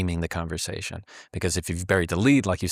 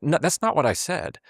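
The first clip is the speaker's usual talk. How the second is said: higher-pitched retold objection; mock protest; 8 semitones higher than usual. Retelling an argument with a partner, protesting. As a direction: push back as if mid-argument, pitch jumping.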